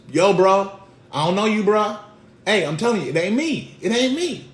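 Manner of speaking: high, loud protest, climbing at the end; agitated denial. Acted. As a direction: insist on innocence, increasingly worked up.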